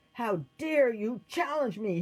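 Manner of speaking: high, loud outraged emperor; indignant shout. A narrator's reading. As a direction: shout down a challenger. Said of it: "he shouted".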